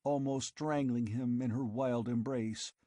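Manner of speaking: plain reading voice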